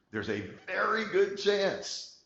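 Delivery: high, slow, hammed-up announcer swell; mock dramatic build. Shown as a demonstration. Presenter teasing the audience with a mock dramatic high slow voice. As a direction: stretch it out like a big reveal.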